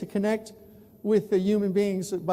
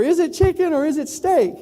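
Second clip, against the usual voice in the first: higher, louder puzzled question; mock naive question; 7 semitones higher, 5 dB louder than usual. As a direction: play the clueless New Yorker. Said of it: "I wrote in the newspaper".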